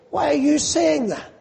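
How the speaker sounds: high, mildly affronted question; offended God. Acted. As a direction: ask it as if insulted. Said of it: "as though God is offended and God is insulted".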